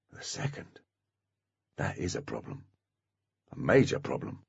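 the second, almost unvoiced and low; hushed dread. A narrator's reading. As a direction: drop "the second" to a near whisper. Repeated phrase dropped to hush.

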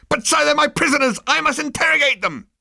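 frantic subordinate squealing at his captain; squealing officer. Acted.

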